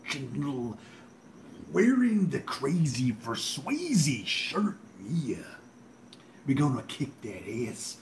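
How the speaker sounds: slow, rasping wrestler promo with drawn-out words; hammed-up hoarse "ooh yeah" growl; Much Man. Bragging on his channel, raspy slow Much Man impression. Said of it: "Much Man impression".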